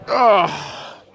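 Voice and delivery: loud strained grunt; effort grunt. Real, not acted. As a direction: grunt as the weight drops. Finishing a hard set.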